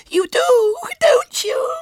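piercingly high, loud whine; quavering little girl. A narrator's reading. Small girl presses William, wailing tearfully. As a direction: wail the question tearfully. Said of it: "she persisted with a quavering lip".

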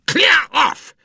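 furious high-pitched shout; loud, enraged bellow; angry roar. A narrator's reading. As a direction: roar it to drive the boy away. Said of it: "roared the professor angrily".

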